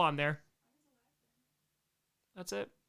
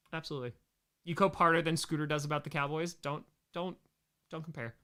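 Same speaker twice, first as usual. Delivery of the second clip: ordinary delivery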